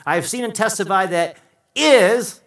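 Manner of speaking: "is" punched high and very loud; emphatic stress on IS. Shown as a demonstration. John testifying as a witness, emphatic "is". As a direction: land hard on the final "is". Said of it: "emphasizes that John is saying is emphatic".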